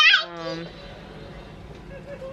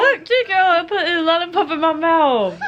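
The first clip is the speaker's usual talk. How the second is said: high squeaky, laughing voice; high playful voice; 9 semitones higher, 4 dB louder than usual. Pretend. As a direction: play a silly high character. Mock voice, laughing.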